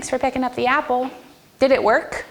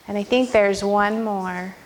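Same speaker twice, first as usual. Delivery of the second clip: ordinary delivery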